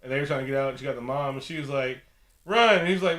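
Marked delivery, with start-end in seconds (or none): 2.4-2.8 loud, high acted warning cry; urgent shout. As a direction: yell it as a sudden warning. Acts out mother calling out.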